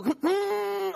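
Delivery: sudden high yelp as Yoshi falls; comic squeal of defeat; odd high noise. Real, not acted. Controller thrown, Yoshi falls off map, high squeal. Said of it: "what noise was that?".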